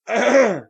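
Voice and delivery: imitating a theatre cougher; loud throat-clearing cough.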